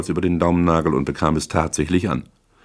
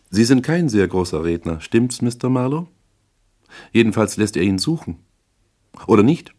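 higher woman character, needling the detective; pressing client; 6 semitones higher than usual. Acted. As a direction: prod him with rising questions. Voices Misses Regan in narration.

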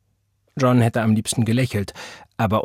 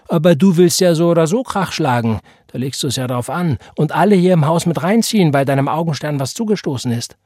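louder, higher reproach; indignant scolding; 6 semitones higher, 6 dB louder than usual. A narrator's reading. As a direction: tell him off heatedly. Tante wirft Vater Streit vor. (empört schimpfend)

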